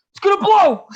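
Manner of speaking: high, loud panicked yell; yelling that the car will blow; retold panicked shout. Friend in the wrecked car yelling it's gonna blow. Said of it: "he kept saying".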